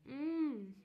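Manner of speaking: loud, high mmm of delight. Real, not acted. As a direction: savour it with a pleased hum. Tasting the muffin.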